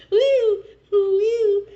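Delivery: high, squeaky party whoops of a tiny ant; mock ant cheering at a donut party. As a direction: whoop like an ant at a sugar rave. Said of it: "can you please act out an ant having a donut party?".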